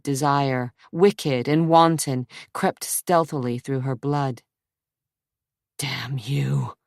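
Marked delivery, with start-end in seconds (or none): 5.8-6.7 hoarse whisper. Acted. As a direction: rasp it under your breath. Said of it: "he whispered hoarsely".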